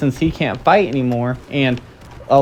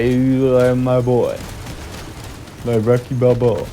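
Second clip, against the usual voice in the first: put-on slow, slurred drunk Italian accent; a drunk Italian guy's accent; drunken Italian accent. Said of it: "a drunken, like, Italian guy accent".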